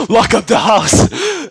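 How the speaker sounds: high, drawn-out cry through laughter; laughing mock alarm. Obito warning his bro that Rin is back, laughing alarm. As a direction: call it out slowly, cracking up.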